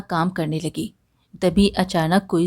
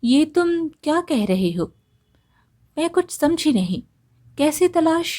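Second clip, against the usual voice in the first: higher-pitched, puzzled questioning; baffled, raised-voice questions with pauses; mildly surprised; 7 semitones higher than usual. A narrator's reading. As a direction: lift the pitch in bewilderment. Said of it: "वेदिका थोड़ा हैरान हुई और बोली".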